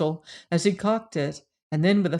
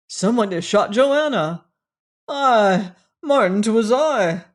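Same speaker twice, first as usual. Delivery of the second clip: louder, raised, shocked delivery; distraught outcry; 3 semitones higher, 7 dB louder than usual. A narrator's reading. Men discovering who has shot Joanna, distraught outcry. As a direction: cry it out in alarm.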